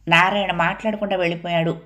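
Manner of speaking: plain reading voice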